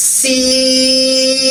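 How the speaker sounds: সিন held long with a hissing s that rises; long drawn sin. Shown as a demonstration. Teacher models the drawn-out letter সিন for learners. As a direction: stretch সিন out slowly, the hiss carried upward. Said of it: "আওয়াজটা উপরের দিকে উঠবে".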